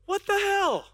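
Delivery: a light imitation of a young girl blurting; girl's exclamation. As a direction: toss it out like a surprised girl. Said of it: "she goes".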